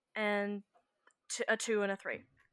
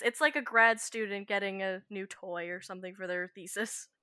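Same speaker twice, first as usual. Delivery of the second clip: usual speaking voice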